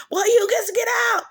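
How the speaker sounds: high, loud mock kid yell; kid shouting. Third kid yelling at siblings, high loud shout. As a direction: shout them out like a bossy kid.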